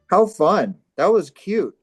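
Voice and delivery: usual speaking voice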